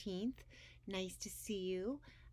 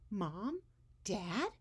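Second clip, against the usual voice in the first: calling out; 5 semitones higher, 4 dB louder than usual. Acted. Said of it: "Edwin called out".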